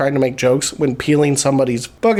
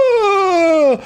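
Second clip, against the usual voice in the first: mock ghost. Playing a bedsheet ghost chasing his girlfriend, high mock wail. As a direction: wail it like a kid in a sheet. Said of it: "puts a sheet over his head and he goes".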